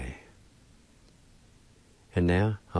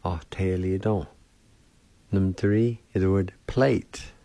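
ordinary talk, nothing marked

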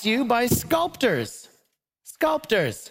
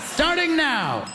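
excited announcement; 6 semitones higher than usual. Acted. Host kicks off debate round.

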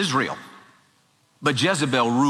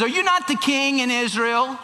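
high-pitched wifely challenge; incredulous challenge; 9 semitones higher than usual. Acted. Queen chides her pouting husband, challenging. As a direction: challenge him in a raised pitch.

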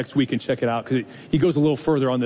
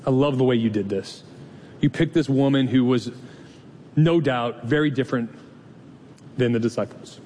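closing prayer. Acted. Pastor praying for the congregation, hushed and reverent.

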